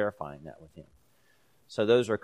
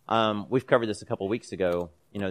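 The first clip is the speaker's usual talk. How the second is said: ordinary talk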